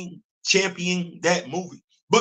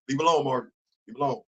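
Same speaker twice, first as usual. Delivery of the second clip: hushed warning; 6 semitones lower, 6 dB quieter, much faster than usual. Real, not acted.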